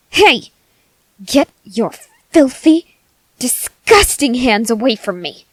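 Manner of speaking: loud, disgusted shout; furious recoil. Acted. Cultist shoving off the listener's hands, disgusted shout. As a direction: bark them off in revulsion. Someone tries to touch her.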